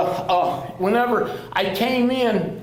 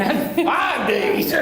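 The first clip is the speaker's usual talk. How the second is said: slow, drawn-out, loud and high; mock outrage; 7 semitones higher, 6 dB louder, much slower than usual. Complaining to the clinic about the five-day wait, mock outrage. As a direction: stretch "five days" in outrage.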